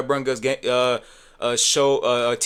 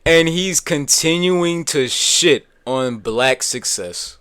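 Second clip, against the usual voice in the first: drawn-out, raised-voice declaration; slow, indignant emphasis; 4 semitones higher, 6 dB louder, slower than usual. Real, not acted. Streamer condemning the comedian to viewers, slow and indignant. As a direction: spell out the accusation word by word, loudly.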